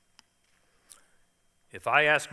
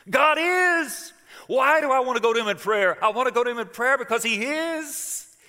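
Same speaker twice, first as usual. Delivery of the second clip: high, loud, fervent preacher's cry; full-force preaching; 11 semitones higher, 7 dB louder than usual. Real, not acted. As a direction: preach it out with everything you have.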